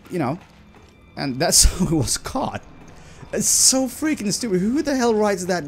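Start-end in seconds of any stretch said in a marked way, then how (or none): none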